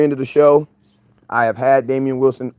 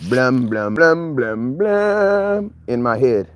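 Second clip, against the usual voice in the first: mouthed dramatic drum beats, slowing on the last; imitated suspense drum; much slower than usual. Waiting for the player's name, imitated suspense drum hits. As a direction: make the dun-dun sound of a big reveal. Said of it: "and then I hear".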